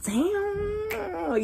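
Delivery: savoring damn. Retold or imitated. Tasting crawfish again, a high, soft savoring damn. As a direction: melt over the taste. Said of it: "I'm like".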